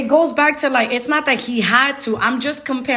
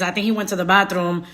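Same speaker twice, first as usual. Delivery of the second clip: normal talking voice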